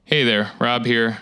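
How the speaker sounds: ordinary delivery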